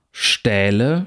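"Stähle" with a long open ä; long vowel. Shown as a demonstration. Lecturer reads minimal pairs, long vowel. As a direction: hold the ä in "Stähle".

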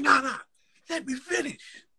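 high insistent voice. Retold or imitated. Pun cuts him off mid-rhyme.

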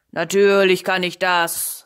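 loud, emphatic self-assurance; boastful. Acted. Sorcerer assures the cat he can, loud boasting. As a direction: boast it out loudly.

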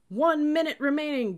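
very loud, high shout; boomed countdown. A narrator's reading. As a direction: boom out the time warning. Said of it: "boomed the cadet".

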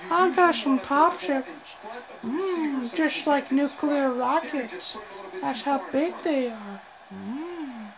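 Herbert the Pervert. Pretend. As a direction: play a leering creep, humming between phrases. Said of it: "I'm sounding like Herbert the Pervert".

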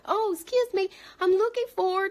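high-pitched "excuse me" request; polite customer. Acted. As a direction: act out a shopper asking for help. Acting out customer asking clerk.

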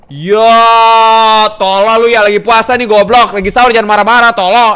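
loud, high outburst; sustained high-pitched angry shout; angry yelling. Real, not acted. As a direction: blow up and scold at full volume. Yells, then apologises for anger.

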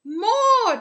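loud, high cheering shout. Acted. Jungle audience cheering for the bear, loud shouted cheer. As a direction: cheer for an encore. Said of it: "cheered the audience".

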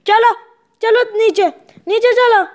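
loud firm command to the dog; firm order. Real, not acted.

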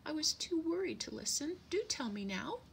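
high, worried little bird voice; anxious little bird confessing; sniffing sparrow. A narrator's reading. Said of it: "sniffed the sparrow".

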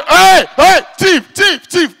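high, loud alarm cry; shouting thief. Retold or imitated. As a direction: yell to the street after the thief.

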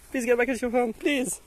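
normal talking voice

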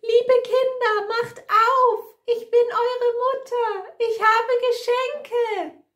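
very high, loud falsetto call; high-voiced wolf. A narrator's reading. Wolf poses as the kids' mother, calling in a high voice. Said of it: "Der Wolf hat eine hohe Stimme".

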